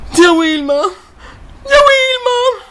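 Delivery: high shrill character squeal; zia Wilma voice. Acted.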